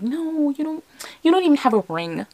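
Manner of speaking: high-pitched mock protest, falling as it speeds up; disbelieving refusal. Movie girl brushing off her boyfriend's promise, high mock disbelief. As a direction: push back in a high, incredulous voice.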